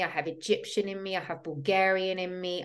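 ordinary talk

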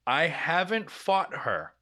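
ordinary delivery

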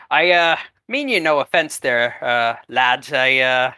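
lightly voiced Scottish drunk; a hint of Scottish apology; Scottish accent. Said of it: "Now I'm Scottish".

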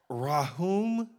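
Rahum with a raspy throaty h; Hebrew guttural h. Shown as a demonstration. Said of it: "with the Hebrew, you go, "Ah".

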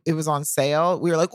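usual speaking voice

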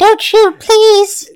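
very high, loud squeaky plea; squeaky helium voice begging for mercy. Acted. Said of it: "And he talks like this".

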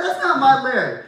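high, teasing mock outrage; teasing disbelief. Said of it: "I'm like".

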